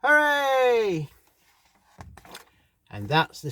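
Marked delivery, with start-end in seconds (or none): 0.0-1.1 high, loud cheer thrown back across the pond; echo shouting back. A narrator's reading. The echo shouts back to the friends, loud and high. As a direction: call it back like a distant echo. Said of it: "the echo shouted back".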